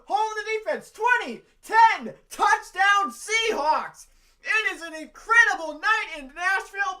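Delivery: roaring, high play-by-play yell; touchdown call. Real, not acted. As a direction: scream the touchdown call at full force.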